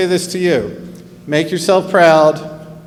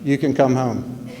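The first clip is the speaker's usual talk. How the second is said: soft, slow, lower motherly reply; tender reassurance; 4 semitones lower, 5 dB quieter than usual. Acted. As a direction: reassure gently, slowing right down. Mother's tender answer to son's question.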